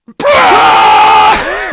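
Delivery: very loud, high, sustained scream; hammed-up Cell scream; imitated anime scream. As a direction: let out a long mock battle scream.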